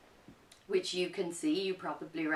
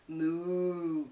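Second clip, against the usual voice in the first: cow moo. Acted. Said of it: "said the cow".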